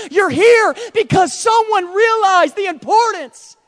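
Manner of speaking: high, loud and slow; shouted preaching. Acted. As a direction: drive it home with a shout.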